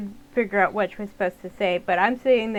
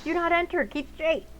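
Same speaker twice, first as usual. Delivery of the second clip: urgent shout; 8 semitones higher than usual. Real, not acted. Scared daughter shouting a road sign at dad. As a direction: yell the warning before dad turns. Reading sign aloud, alarmed.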